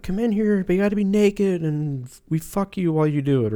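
high-pitched mock invitation; hippie host. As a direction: coax like a caricatured Austin hippie. Imitating hippie-like Austin clinic staff.

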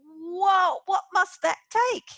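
high, loud awestruck gasp of wonder; amazed whoa. Retold or imitated. Concertgoer leaving a show, amazed whoa. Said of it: "I come away from it going".

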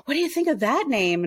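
lightly put-on high voice; imitated high voice. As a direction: lift the pitch, mimicking his playful pitch. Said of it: "then he did it again".

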